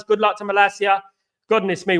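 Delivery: ordinary talk, nothing marked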